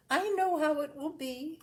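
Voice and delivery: a hint of a bossy sister's voice; lightly voiced sister. A narrator's reading. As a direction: lightly play the sister who knows best. Sister's warning, quoted.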